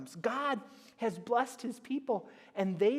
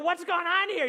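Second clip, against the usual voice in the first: mock surprise; 8 semitones higher, 6 dB louder than usual. Parent finds chores undone.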